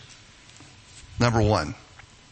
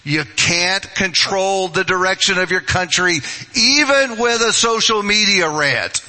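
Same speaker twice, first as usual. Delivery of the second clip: louder, higher, driving the point home; emphatic; 8 semitones higher, 4 dB louder than usual. Real, not acted. Pastor warns Facebook posters, louder and higher. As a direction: hammer it at the congregation. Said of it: "let me say it with emphasis".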